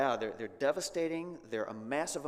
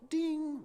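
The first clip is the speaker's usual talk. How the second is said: a high, sharp bell "Ding!" for round one; boxing bell ding; 4 dB louder than usual. Retold or imitated. Preacher sounding a bell as God and Job square off, high ding. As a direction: ring the fight bell with your voice.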